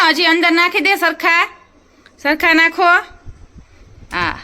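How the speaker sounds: eager push to get it done. Real, not acted. Urging toddler to load washer.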